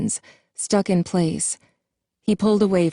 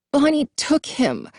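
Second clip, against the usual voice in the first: much higher, words choked out; choked, shaking voice; 9 semitones higher than usual. A narrator's reading. Jason telling the others about Bonnie, choked and shaking. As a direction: force the words out through tears. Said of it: "choking out the words one by one, his voice shaking".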